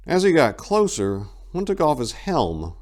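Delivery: plain reading voice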